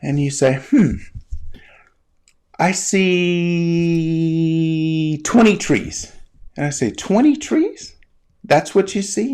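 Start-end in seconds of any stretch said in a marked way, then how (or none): 0.7-6.1 long drawn-out "see", thinking aloud; pondering. Acted. Imagined learner asked what they see, slow pondering. 6.9-9.3 high, drawn-out questioning; incredulous echo. Acted. Teacher questioning the learner's answer, incredulous.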